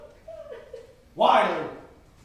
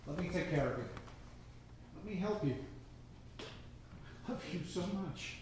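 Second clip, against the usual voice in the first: tender; 2 semitones lower, 8 dB quieter than usual. Acted. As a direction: comfort her gently. Man tenderly proposing to her.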